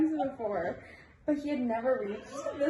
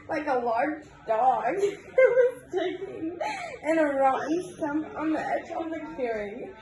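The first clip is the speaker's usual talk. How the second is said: reading through giggles; giggling; 3 semitones higher than usual. Real, not acted. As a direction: giggles bubbling up. Reader giggles through a story.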